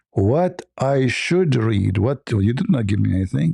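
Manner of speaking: drawn out, very slow, puzzled "What I should read"; slow puzzled question. Shown as a demonstration. Muhammad to the angel, asking what to read, very slow and puzzled. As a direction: spell out the confusion slowly. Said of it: "So obviously he's saying".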